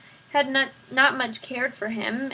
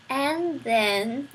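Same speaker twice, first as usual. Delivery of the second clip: Roscuro coaxes "And then" gently, at his usual level; a lightly voiced rat coaxing "And then"; Roscuro encouraging. A narrator's reading. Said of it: "encouraged Roscuro".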